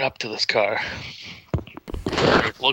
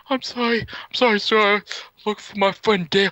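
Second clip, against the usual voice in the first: scared apology; 10 semitones higher than usual. Retold or imitated. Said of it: "it's just like".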